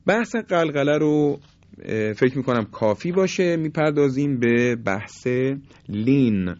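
normal talking voice

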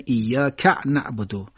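ایاک ending in a caught ka' before نعبدو; intrusive glottal stop. Shown as a demonstration. Teacher imitating a faulty reciter, inserted hamza. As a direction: cut ایاک with a glottal catch after ka. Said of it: "یه حرف همزه".

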